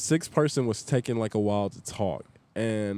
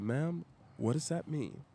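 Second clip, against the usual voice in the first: softly, slowly challenge her; restrained warning, drawn out and quiet; controlled confrontation; 2 semitones higher, 8 dB quieter than usual. Retold or imitated. Server confronts customer over remark about his family, quietly challenging.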